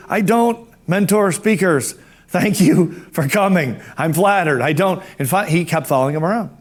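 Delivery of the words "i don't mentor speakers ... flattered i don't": slow, measured orator's impression; Doctor McFarland. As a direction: imitate a formal speaker declining. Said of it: "the way he talked, he'd say".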